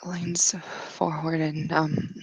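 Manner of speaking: slow, drawn-out whisper; whisper, leaning in slowly; slow hushed whisper before a kiss. A narrator's reading.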